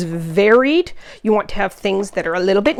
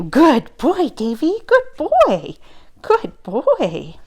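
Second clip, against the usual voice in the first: puppy praise; 7 semitones higher, much slower than usual. Real, not acted.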